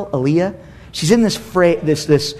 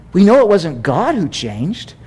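ordinary talk

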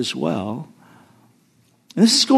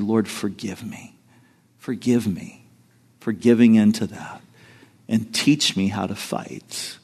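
softer, hushed plea for forgiveness; quiet prayer; 8 semitones lower, 6 dB quieter than usual. Retold or imitated. Praying to the Lord after hanging up, softer and humble. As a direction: pray it low and humble.

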